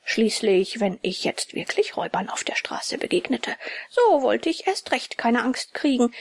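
ordinary delivery, nothing marked

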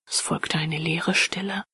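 usual speaking voice

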